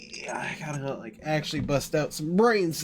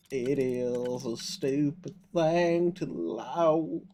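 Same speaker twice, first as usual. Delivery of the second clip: put-on southern accent; much slower than usual. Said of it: "I go southern when I hold a shotgun".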